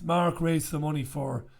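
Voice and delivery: normal talking voice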